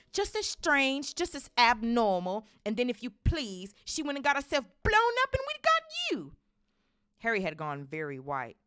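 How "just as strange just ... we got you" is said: high, loud, climbing tirade; furious rant. A narrator's reading. Aunt spitting out her sister's story, ranting. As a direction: build to a shriek of contempt. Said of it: "went ranting on".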